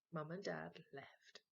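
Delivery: usual speaking voice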